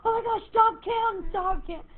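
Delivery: hammed-up plea to stop the countdown; mock plea. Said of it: "they just all went".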